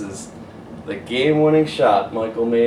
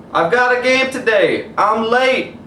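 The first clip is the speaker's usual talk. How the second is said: high, louder call over shoulder; yelling back; 12 semitones higher, 8 dB louder, slower than usual. Acted. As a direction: shout back while running off. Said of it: "Michael yelled back".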